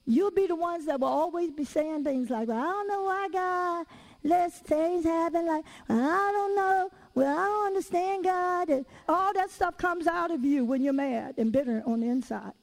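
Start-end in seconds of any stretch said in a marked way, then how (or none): 2.5-8.7 mock complaining. Preacher mimicking people mad at God, mock complaining.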